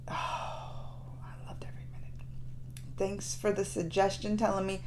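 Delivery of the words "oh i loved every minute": moved whisper. Real, not acted. Moved after sad video.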